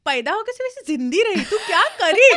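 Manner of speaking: laughing mock rant at the cook, high, loud and fast; mock scolding in Hindi. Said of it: "So I speak like her".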